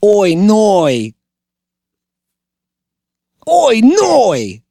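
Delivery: put-on a Kiwi accent realizing he's late, rising alarm; New Zealander late for work. Host mimics a New Zealander late for work, Kiwi accent. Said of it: "an impression of, uh, a New Zealander".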